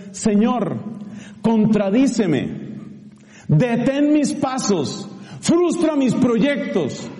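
slow, rising, pleading prayer. Shown as a demonstration. Preacher teaching the faithful a prayer, slow pleading. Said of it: "te voy a enseñar la oración".

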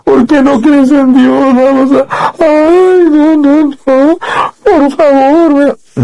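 high, hammed-up fake sobbing, slow; mock crying. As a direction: pretend to weep over their unbelief in a wailing high voice. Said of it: "yo me ponga a llorar".

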